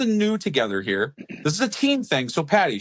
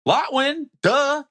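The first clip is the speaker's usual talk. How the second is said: playful; 12 semitones higher, much slower than usual. Pretend. Playful brand plug.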